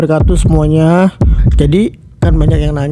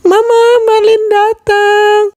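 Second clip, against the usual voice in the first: higher, slow call into the house; slower than usual. Acted. Daughter calling her mother inside, drawn-out call. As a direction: call for mama from the door.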